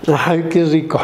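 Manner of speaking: lightly acted drunk mumbling; halting drunk with long gaps between words. Drunks being imitated for the audience, halting tipsy voice. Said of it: "Los borrachos".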